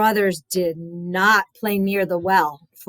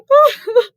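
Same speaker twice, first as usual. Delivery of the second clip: laugh; 7 dB louder than usual. Real, not acted. Storyteller laughs.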